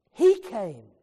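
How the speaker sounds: "he came" plotseling luid uitgeroepen als hoogtepunt; sudden loud climax. Acted. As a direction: breek uit op "he came", veel harder. Sermon refrain, climactic burst.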